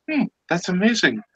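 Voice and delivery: high-pitched mock amazement; acted wonder, pitched high; amazed assistant. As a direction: react as an impressed underling. Joke assistant admires captain.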